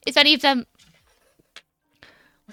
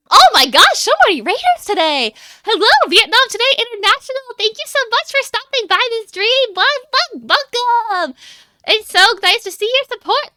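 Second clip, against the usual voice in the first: high, bubbly thanks to arriving raiders; excited raid welcome; 9 semitones higher, 4 dB louder than usual. Real, not acted. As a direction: greet the newcomers in a much higher, thrilled voice. Greeting a sudden influx of raiders.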